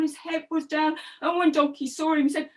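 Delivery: usual speaking voice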